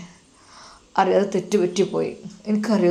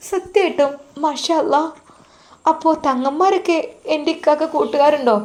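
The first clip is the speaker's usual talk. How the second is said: put-on much higher young wife's voice, delighted surprise; surprised young wife; 9 semitones higher, 3 dB louder than usual. Wife Nusaiba amazed at her husband's friends, high and delighted. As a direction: gasp it out in a high young woman's voice.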